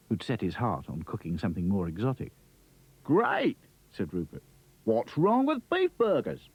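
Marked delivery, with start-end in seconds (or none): 3.0-6.5 high, bouncy Aussie voice; a cheery kangaroo exclaiming; Australian kangaroo. Acted. Kangaroo Rupert's voice.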